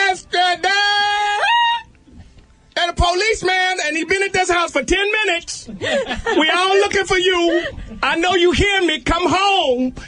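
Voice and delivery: put-on very high, laughing call to a runaway kid; mother calling out. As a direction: coax the kid home with a threat.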